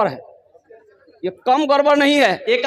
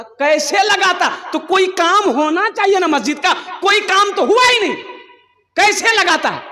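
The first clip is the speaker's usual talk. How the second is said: high-pitched, acted indignation; mock-angry cross-examination; exasperated challenge; 8 semitones higher than usual. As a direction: demand an answer with rising outrage. Villagers angrily question the collector.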